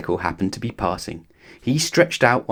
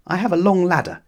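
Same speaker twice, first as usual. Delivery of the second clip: lightly voiced fretful neighbour, higher; a worrier pleading for help, pitched up; Mister Worry's voice; 9 semitones higher than usual. A narrator's reading. Mister Worry calling from his house, a higher fretful plea.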